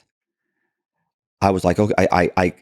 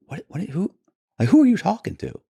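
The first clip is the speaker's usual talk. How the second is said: sudden high-pitched disbelief; thinking the husband's denial is a show for the microphones, stunned; incredulous; 14 semitones higher than usual. Retold or imitated. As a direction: squeak out who are you talking to in shock. Baffled at husband's claim.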